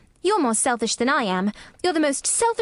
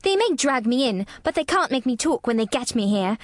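put-on higher, defiant child voice; fierce; 6 semitones higher than usual. A narrator's reading. Girl vows never to talk to sick boy, fiercely stubborn. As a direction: obstinate little girl digging in. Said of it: "said Mary fiercely".

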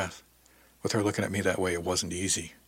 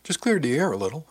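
higher, lilting, playful understatement; grinning; 6 semitones higher, 3 dB louder than usual. A narrator's reading. Answering a bewildered shipmate, playfully grinning. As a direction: say it through a grin, pitch swooping up. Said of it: "I said with a grin".

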